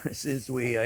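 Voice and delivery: quiet, slow chuckling echo of his own line; laughing repeat. Real, not acted. Cook amused at his own words, laughing quietly. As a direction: laugh at your own phrase and say it again softly.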